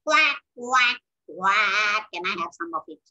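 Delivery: loud quacks sinking into a low voice; quack, then wheedle like a duck; low duck voice asking a favour; begging duck. Acted. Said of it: "the duck comes".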